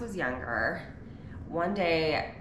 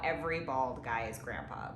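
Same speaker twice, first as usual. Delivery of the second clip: normal talking voice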